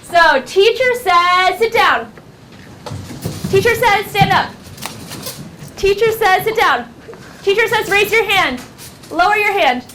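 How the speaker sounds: high, crisp commands to a class; game-leader teacher. Shown as a demonstration. Teacher giving Teacher Says commands, high and lively.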